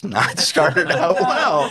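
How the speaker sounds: slow, high, laughing; laughing dismay. Retold or imitated. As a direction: laugh through the dread, slowly. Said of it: "And I'm like".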